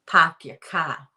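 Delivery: broad "pahk yah cah" Boston sound; Boston accent. Shown as a demonstration. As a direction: say it like a Bostonian, drawn out. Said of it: "That Boston accent".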